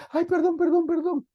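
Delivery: high, whining mock plea for forgiveness; whiny begging husband. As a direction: mimic a sniveling husband begging. Husband begging again, mocked.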